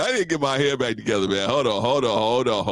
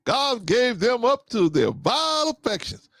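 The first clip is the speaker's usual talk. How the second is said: higher, slow sermon voice, mocking a friend; a friend's scripture quote, mocked in a high, slow preacher voice; 13 semitones higher, slower than usual. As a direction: intone the Bible verse like a pompous preacher. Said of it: "Vaughn said".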